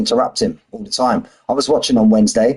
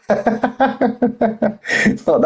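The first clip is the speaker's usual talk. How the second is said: laughing; 11 semitones higher than usual. Real, not acted. Laughing at his own joke.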